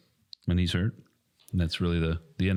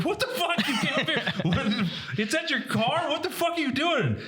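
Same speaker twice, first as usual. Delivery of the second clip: high, incredulous what-the-fuck at the player, laughing through it; disbelieving outburst. Retold or imitated. Retelling yelling at the player who left his eye protection in the car, high laughing disbelief. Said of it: "I'm like".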